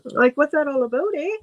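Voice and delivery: playful put-on Canadian lilt; mock Canadian accent. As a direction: tease with a Canadian accent, rising on "eh". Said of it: "Do you think you have an accent".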